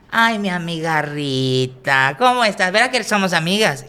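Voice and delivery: high, loud and slow at first, sugary hostess voice; drag persona greeting. Retold or imitated. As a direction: coo at an old lady like a best friend. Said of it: "busco una viejita".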